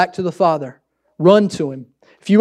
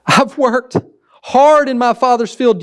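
self-righteous son; 6 semitones higher than usual. Acted. Older son voiced, self-righteous.

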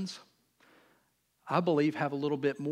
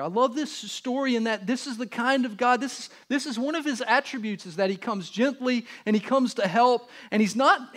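ordinary talk, nothing marked

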